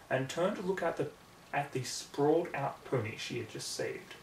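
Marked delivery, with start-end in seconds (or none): none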